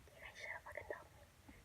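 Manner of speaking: harsh whisper of an unspoken thought; inner thought whisper. Retold or imitated. Thinking at the neighbor kids, in a whispered curse. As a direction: whisper it like words kept in her head. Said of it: "in my head, I was just like".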